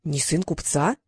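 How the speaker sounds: low, mildly surprised question; understated surprise, rising at the end. A narrator's reading. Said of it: "удивился работорговец".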